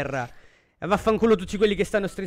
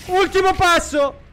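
slow excited build; 9 semitones higher, 7 dB louder, slower than usual. Real, not acted. Caster before the final kill.

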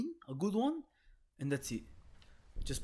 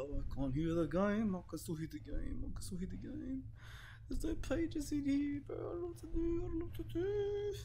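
frightened whine; 12 semitones higher, 4 dB quieter than usual. Real, not acted. Player still hears the game, helpless rising whimper. Trying to block out scary game.

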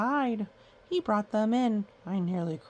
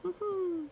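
high giggle; 12 semitones higher than usual. Acted. Character laughs after line.

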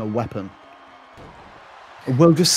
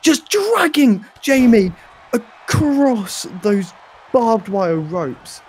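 excited; 10 semitones higher, 4 dB louder, slower than usual. Real, not acted. Commentator reacts to brutal spot.

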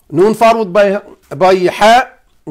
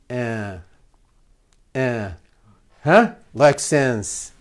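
ordinary delivery

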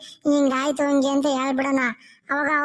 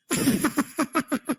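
staccato acted ghostly laugh; ghost's laugh. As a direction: laugh in a long string of short bursts. Ghost pleased with the song. (ದೆವ್ವದ ನಗು)

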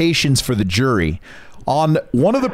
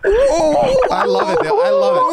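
laughing; much slower than usual. Real, not acted. Laughing at the impression.

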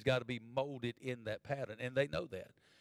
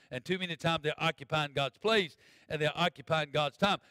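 ordinary talk, nothing marked

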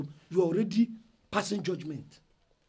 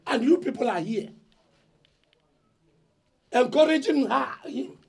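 louder and higher at the crowd; angry accusation; 6 semitones higher, 6 dB louder than usual. Acted. Eldest son shouting blame at the family elders. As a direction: point at them all, accusing.

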